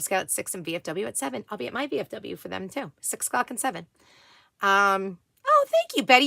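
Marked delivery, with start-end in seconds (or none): 5.5-6.2 high, bright, touched thanks; delighted thanks. Real, not acted. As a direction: gush your thanks. Thanking a viewer.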